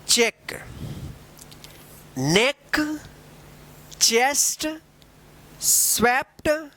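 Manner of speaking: each word read slowly with long pauses; careful pronunciation drill. Shown as a demonstration. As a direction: read the list word by word, pausing after each. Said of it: "repeat करते हैं direct".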